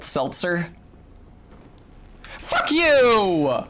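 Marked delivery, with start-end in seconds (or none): 2.4-3.6 angry shout. Real, not acted. Yelling at game enemy.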